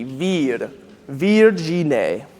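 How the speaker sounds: Virgine with a bright, open E, vir-JEE-neh; bright E demo. Shown as a demonstration. Choir director shows the vowel, bright E in Virgine. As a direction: sing the E in Virgine bright and forward. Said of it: "It's a very bright E".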